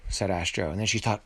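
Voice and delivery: usual speaking voice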